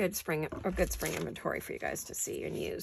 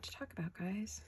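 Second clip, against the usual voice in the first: whispering; 11 dB quieter than usual. Real, not acted. Whispered aside while holding breath.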